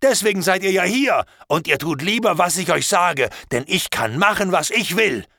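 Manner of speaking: angry threat. Acted. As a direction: rage at the captives and scare them. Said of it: "da wurde ich noch wütender als vorher". (lauter, wütender, drohender Ausbruch)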